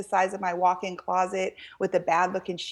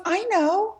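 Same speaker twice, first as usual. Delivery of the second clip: put-on little girl voice; 12 semitones higher than usual. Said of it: "She goes".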